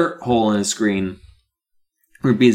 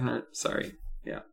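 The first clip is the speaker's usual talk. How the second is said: much softer, meek and apologetic; sheepish backing down; 11 dB quieter than usual. Retold or imitated. Gary backing off after the other bees scold him, meek and quiet. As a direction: shrink away, mumbling apologies. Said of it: "He's like".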